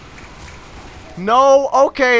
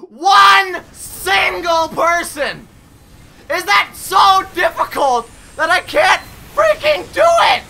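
higher, louder, breaking tearful yells; furious crying shout; 6 semitones higher, 6 dB louder than usual. Real, not acted. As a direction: scream in desperate rage. Raging, near tears.

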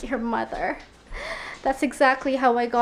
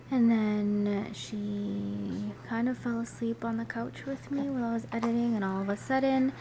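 hushed voice; 2 semitones lower, 10 dB quieter than usual. Real, not acted. Talks softly at 3 a.m.

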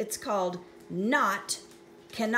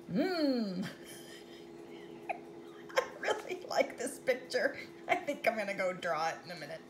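ordinary delivery

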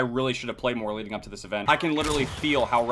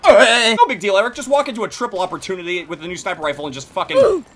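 ordinary delivery

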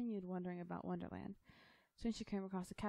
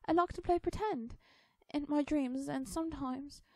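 put-on high, loud girlish outburst; indignant little-girl protest; snapping; 9 semitones higher, 9 dB louder, slower than usual. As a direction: snap back in a high child's voice, stretching the first words. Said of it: "Alice snapped at the Hatter".

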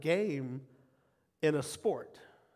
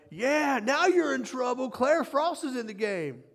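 high-pitched proud crowing; excited cheering dad; 7 semitones higher, 3 dB louder than usual. Retold or imitated. Dad at his daughter's first game, cheering excitedly. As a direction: crow it like a boastful fan, high and lively. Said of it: "and I went".

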